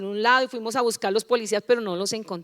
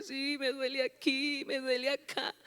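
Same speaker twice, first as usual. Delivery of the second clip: mock self-pity; 3 semitones higher, 8 dB quieter than usual. Believer being stroked by the devil complains, mock self-pity. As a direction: play a pitiful sufferer listing aches.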